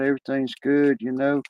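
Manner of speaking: ordinary talk, nothing marked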